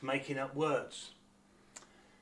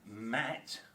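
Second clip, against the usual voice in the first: slow contrast; 3 semitones lower than usual. Shown as a demonstration. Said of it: "this is our short vowel".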